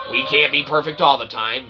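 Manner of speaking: ordinary talk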